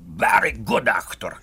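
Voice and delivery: lightly voiced Russian accent, quoting a client; a hint of a Russian accent retelling praise; Russian accent, mildly done; imitating Sikorsky's Russian accent. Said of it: "afterwards he said".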